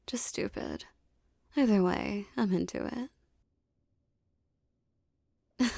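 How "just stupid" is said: teasing whisper. Acted. Teasing his partner about being stupid, whispered. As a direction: drop to a whisper for the punchline.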